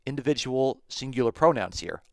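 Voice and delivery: ordinary delivery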